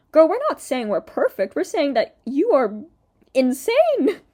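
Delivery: loud, high and indignant; exasperated retort. Real, not acted. Commentator answering Colleen's sorry lyric, loud exasperated retort. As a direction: build to an outraged peak on insane.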